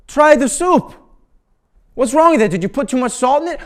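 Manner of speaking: very high, loud frantic shriek; old man losing it over soup; screaming demand. Retold or imitated. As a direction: scream it at him to taste. Eric escalating demand.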